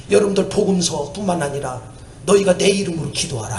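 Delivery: usual speaking voice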